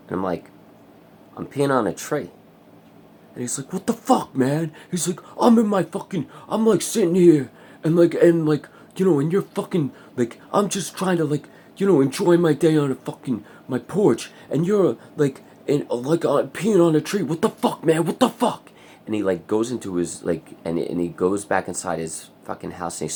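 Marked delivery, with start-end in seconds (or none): 1.4-2.3 raised, higher matter-of-fact answer; loud blunt retort; matter-of-fact reply. Retold or imitated. His own reply acted out. 3.7-18.7 high, loud outraged neighbour rant; loud high mock neighbour rant.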